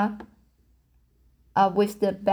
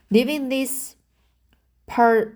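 normal talking voice

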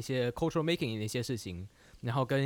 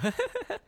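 short high awkward giggle; nervous laugh. Real, not acted. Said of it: "啊，好尴尬哦".

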